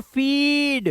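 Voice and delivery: फीड with the ee held long; slow, drawn-out फीड; long-vowel demo. Shown as a demonstration. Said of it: "अब कैसे पढ़ेंगे".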